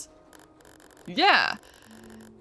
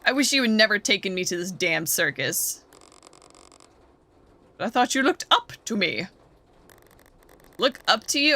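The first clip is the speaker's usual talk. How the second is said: ordinary talk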